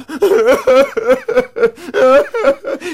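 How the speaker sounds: high, loud stuttering sobs; whimpering wail. A narrator's reading. Beaten Anansi getting up, whimpering. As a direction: whimper in hammed-up pain.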